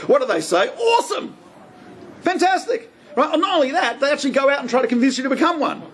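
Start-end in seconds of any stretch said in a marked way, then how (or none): 0.7-2.9 high, gushing mock cheer; hammed-up overjoyed welcome; mock delight. Rabbi mimics other religions welcoming a convert, gushing. Mock enthusiastic church response.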